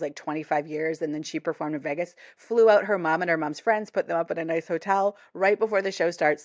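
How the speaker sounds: normal talking voice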